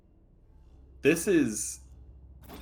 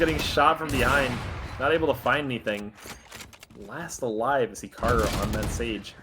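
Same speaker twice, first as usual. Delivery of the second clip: esports caster. Acted. Live play-by-play casting.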